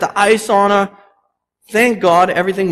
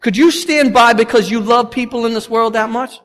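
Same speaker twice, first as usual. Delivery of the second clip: ordinary talk, nothing marked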